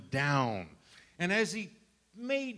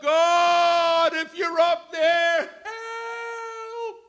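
high, loud, breaking plea for help; desperate yell; 12 semitones higher, 8 dB louder, much slower than usual. Retold or imitated. Man dangling from a branch yells up to God for help. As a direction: yell up into the sky, hanging on. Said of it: "he yelled up".

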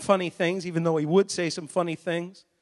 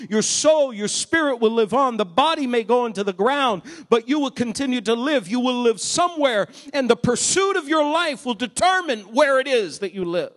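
louder preaching building to a shout; rising preaching; 4 semitones higher, 5 dB louder than usual. Acted. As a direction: drive the point home to the congregation.

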